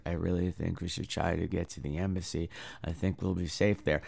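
flat, low, quiet monotone line reading; mock the dull actor's lifeless delivery; lightly imitated wooden movie hero. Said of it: "you delivered all of his lines right now".

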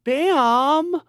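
hammed-up wife scolding her husband; mocking the wife. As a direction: squeal it like a hurt bride. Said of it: "then she was like".

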